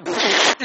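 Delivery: sudden loud laugh; a short helpless laugh that bursts out; laughing at the kids' funny misbehaviour; burst of laughter. Retold or imitated. Both parents laugh at naughty kids.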